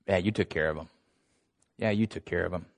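low, hushed, knowing comeback; quiet, pointed retort; dry reply. Retold or imitated. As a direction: say it softly and low, deadpan. God's dry reply, voiced.

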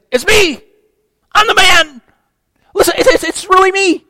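high, loud, eager pleading; excited insistence. Acted. Healed beggar insisting to doubtful neighbors, excited. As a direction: insist it's really you, thrilled. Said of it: "he's so excited".

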